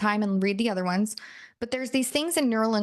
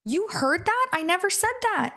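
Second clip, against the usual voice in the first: high-pitched, mock-offended denial; acted indignation, pitched well up; indignant couple's-fight protest; 9 semitones higher than usual. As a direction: protest as in a lovers' tiff. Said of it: "a couples fight with somebody and you're like".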